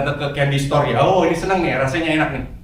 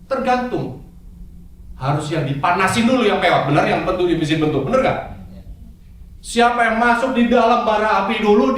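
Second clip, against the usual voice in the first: ordinary talk, nothing marked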